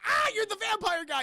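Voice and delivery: high, loud startled cry; mock alarm. Felicia discovering the vampire, mock alarm. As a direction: yelp it in comic fright.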